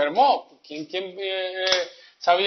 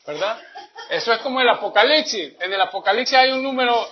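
ordinary delivery

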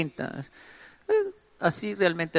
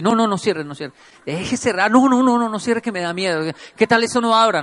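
panicked higher, louder no's; frantic pleading; 5 semitones higher, 4 dB louder than usual. Retold or imitated. Friend stuck in washer begs not to close, panicked. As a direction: beg him not to shut the door, scared.

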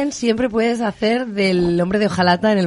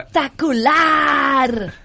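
drawn-out shout; 4 semitones higher, much slower than usual. Pretend. Dramatic drawn-out exclamation.

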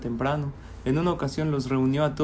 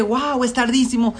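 high, startled gasp of a line; sudden panic at the late hour; alarmed surprise; 7 semitones higher than usual. Acted. Businessman waking late, startled alarm.

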